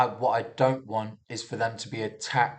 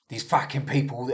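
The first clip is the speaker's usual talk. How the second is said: lightly put-on Cockney, a bit louder; Cockney accent; 2 semitones higher, 4 dB louder than usual. Imagined police officer on the people he faces, in a light Cockney accent. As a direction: slip into a mock Cockney for the quote. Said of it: "did a Cockney accent".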